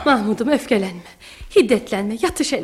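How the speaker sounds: calm an angry son down; soothing. Acted. Mother calms her angry son. (yatıştırıcı, alçak perdeden)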